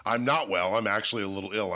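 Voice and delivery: normal talking voice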